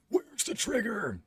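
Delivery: high, drawn-out strained demand; hammed-up superhero interrogation; Batman. Host searches for a villain line, lands on a strained superhero voice. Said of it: "I see that's Batman, not Jigsaw".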